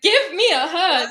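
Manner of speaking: high, loud, gushing invitation; excited welcome. Retold or imitated. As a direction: throw your arms open with your voice. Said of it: "I'm like".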